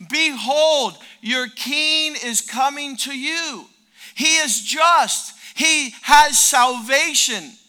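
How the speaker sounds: loud, high ringing proclamation; proclaimed shout. Acted. Preacher booming scripture to the congregation. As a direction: call it out to the whole room.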